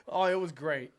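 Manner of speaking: normal talking voice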